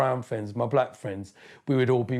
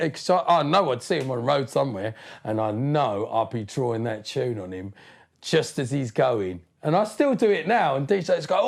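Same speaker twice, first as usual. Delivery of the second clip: ordinary talk, nothing marked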